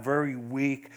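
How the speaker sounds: normal talking voice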